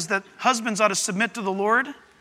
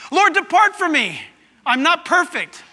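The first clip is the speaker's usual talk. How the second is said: loud, high cry of unworthiness; impassioned plea; 7 semitones higher, 5 dB louder than usual. Acted. Sinner begs the Lord to depart, impassioned plea.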